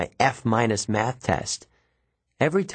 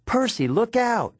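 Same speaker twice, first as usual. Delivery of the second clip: warning cry; 13 semitones higher than usual. A narrator's reading. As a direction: shout to save him. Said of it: "Annabeth cried".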